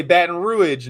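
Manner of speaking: "Baton Rouge" with Rouge as ROO-idge; drawled local "Baton Rouge", ending -idge; local pronunciation. Shown as a demonstration. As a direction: say "Baton Rouge" slowly, two syllables in Rouge. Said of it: "how the accent's gonna start to pronounce itself".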